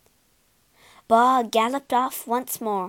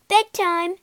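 loud, high announcement; announcing; 9 semitones higher, 5 dB louder than usual. Acted. Robber girl announces bedtime, high and loud. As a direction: declare bedtime for everyone. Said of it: "she announced".

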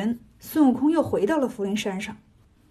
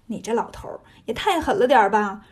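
plain reading voice

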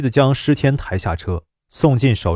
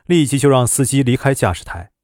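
usual speaking voice

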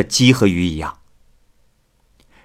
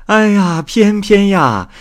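high, loud, drawn-out playful cry; teasing exclamation; 10 semitones higher, 5 dB louder, slower than usual. Acted. Visiting wife teases Pianpian about her new lover, high playful call. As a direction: call her name out slowly, full of teasing delight.

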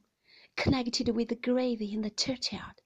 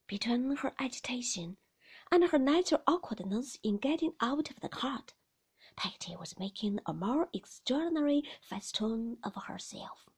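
plain reading voice

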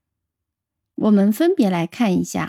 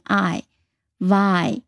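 slow the syllable viron right down; teacher slowing down to show the vowel; slow demo; 2 semitones lower, much slower than usual. Shown as a demonstration. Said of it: "这里的I就发成它单词原本的发音".

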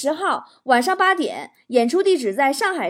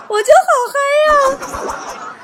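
high, loud, drawn-out whoop; over-the-top excited cry; hyped up; 13 semitones higher, 7 dB louder, much slower than usual. Pretend. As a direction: stretch it out in giddy mock elation.

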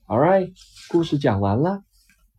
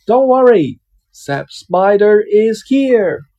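louder, higher, slow hero announcement; heroic call; 7 semitones higher, 8 dB louder, slower than usual. Acted. Spider announcing himself to the wolf, bold hero call. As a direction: arrive like a storybook hero.

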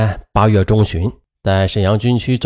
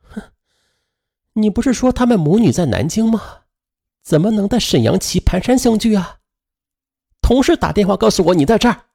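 higher, scornful accusing voice; cold sneer; 7 semitones higher than usual. A narrator's reading. Betrayed wife confronting husband, cold and scornful. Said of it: "可冯启华却冷笑".

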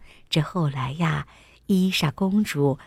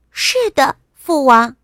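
high, loud, happy princess; 6 semitones higher, 7 dB louder than usual. A narrator's reading. Princess sitting with her father, happy. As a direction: answer brightly in a high little-girl voice. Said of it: "伊莎开心地说".

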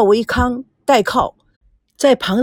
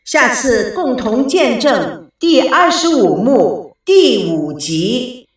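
slow, drawn-out programme sign-off; radio announcer; slower than usual. Real, not acted. Host inviting listeners to next episode, announcing. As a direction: announce the next instalment with measured flourish.

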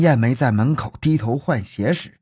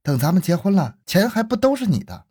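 higher-pitched gentle deflection; tactful, high-pitched reassurance; tactful put-off; 7 semitones higher than usual. A narrator's reading. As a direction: put them off politely, voice lifted. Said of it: "委婉地说".

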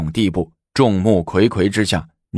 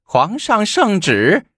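much higher, slow drawn-out herald's call; 12 semitones higher, slower than usual. Acted. Palace eunuch announces the imperial edict, slow high call. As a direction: announce the decree across the hall. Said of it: "叫着".

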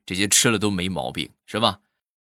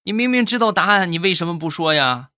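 much higher, exasperated granny; puzzled grandma; 8 semitones higher, 3 dB louder than usual. Acted. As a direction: demand an answer in disbelief. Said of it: "奶奶".

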